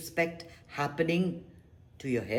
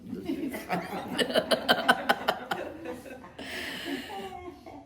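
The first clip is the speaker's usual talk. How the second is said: high laugh; 12 semitones higher than usual. Real, not acted. Laughs at own joke.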